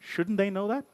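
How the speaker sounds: normal talking voice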